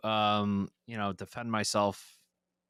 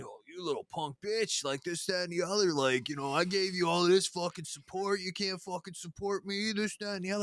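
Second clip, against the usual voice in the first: higher mock rant, shifting voice, sneering; imitating a coked-up rant; 9 semitones higher than usual. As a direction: ape the high guy ranting at him. Impersonating the high radio guy.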